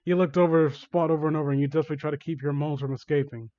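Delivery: plain reading voice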